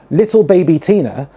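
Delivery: ordinary delivery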